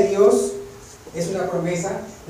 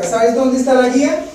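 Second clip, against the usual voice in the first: impassioned preaching; 6 semitones higher, 9 dB louder than usual. Real, not acted. Preacher exhorting.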